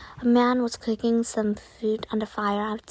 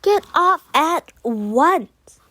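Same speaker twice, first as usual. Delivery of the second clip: furious boatwoman; 5 semitones higher, 8 dB louder, slower than usual. A narrator's reading. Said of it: "she cried".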